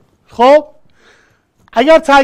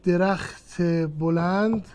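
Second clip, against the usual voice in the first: slow, spelled-out example phrase; teacher slowing down on درخت بلند; slow demo phrase; 4 semitones lower, 9 dB quieter, slower than usual. Shown as a demonstration. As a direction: lay the phrase out slowly for the class.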